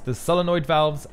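ordinary talk, nothing marked

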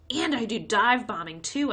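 loud eager brag; a boy bragging loudly to grown-ups; boastful kid. A narrator's reading. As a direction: show off like a kid trying to impress. Crash boasting to impress.

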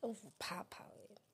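slow, breathy teasing whisper. Real, not acted. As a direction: whisper it slowly as a jab. Teasing aside to Thabo.

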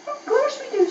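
high eager brownie reply; brownies chorus. A narrator's reading. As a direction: chirp it back gleefully. Brownies answering the dragon.

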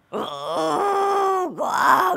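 loud, gushing outburst; excited shout. Real, not acted. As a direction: squeal it out with delight. Gushing over the purring monkey.

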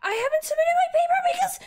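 high, fast upset voice; high-pitched rushed frustration. Real, not acted. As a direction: squeal it out in disbelief. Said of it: "I'm actually upset now".

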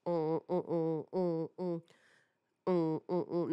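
low, soft pulsed humming; rhythmic mock sound-effect hums; imitated low hum. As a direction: hum short repeated "mm" sounds. Imitates doors opening for the meeting.

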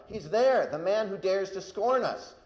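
loud, high accusing cry. A narrator's reading. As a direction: cry out across a hilltop in outrage. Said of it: "cried out".

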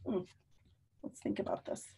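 hushed thinking-aloud whisper. Real, not acted. Teacher whispering while pondering for the kids. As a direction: muse it softly to yourself.

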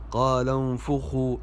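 "انفخوا" as unfukhu; damma on fa. Shown as a demonstration. Teacher repeats the correct reading, "انفخوا" said unfukhu. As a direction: say "انفخوا" with rounded u after the f. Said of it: "الفاء مضمومة وليست مفتوحة".

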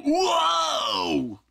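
high shout of awe; amazed caveman. Retold or imitated. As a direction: blurt like a stunned caveman. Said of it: "troglodytes who are just like".

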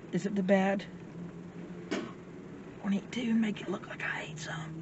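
hushed secret whisper. Real, not acted. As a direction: whisper so the camera misses it. Secret aside to friend.

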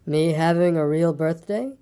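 slow, disbelieving donkey; slow, ponderous question; Eeyore. A narrator's reading. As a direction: draw it out in dull wonder.

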